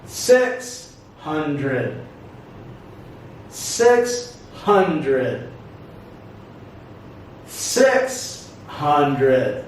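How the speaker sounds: "Six hundred" slowly, with a pause between words; syllable stress demo. Shown as a demonstration. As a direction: split "Six hundred", falling after the first word. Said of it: "syllable stress".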